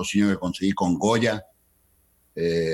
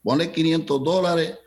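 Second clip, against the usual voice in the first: put-on Cuban accent; 7 semitones higher than usual. The manager granting five hundred dollars, Cuban accent. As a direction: grant it to be rid of him, Cuban style. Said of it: "con el acento cubano".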